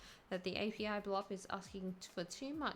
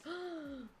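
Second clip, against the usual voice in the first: sharp gasp; 9 semitones higher than usual. Acted. Shocked reaction in debate.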